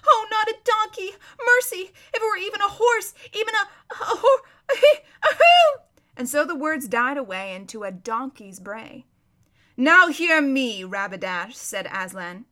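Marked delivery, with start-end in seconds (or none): 0.1-5.8 high, panicked wail breaking into bray; screaming. Acted. Prince turning into a donkey, screaming for mercy. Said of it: "he screamed out". 9.8-11.6 commanding. Acted. Lion passing judgment on the donkey prince, commanding.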